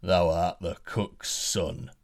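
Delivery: slow, lightly voiced giant; a hint of a menacing giant; giant's verdict. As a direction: pronounce it slowly like a giant. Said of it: "said the giant".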